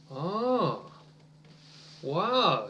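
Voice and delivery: usual speaking voice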